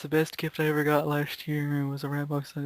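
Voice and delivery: ordinary talk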